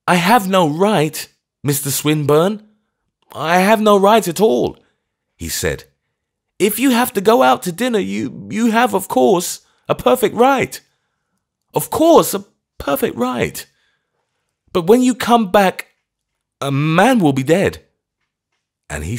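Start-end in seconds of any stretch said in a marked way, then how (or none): 0.1-4.8 put-on high, loud pleading from a frantic old clergyman; feverish old vicar. A narrator's reading. Vicar rises with dignity. 6.6-17.9 put-on high, loud pleading from a frantic old clergyman; feverish old vicar. A narrator's reading. Vicar's desperate warning.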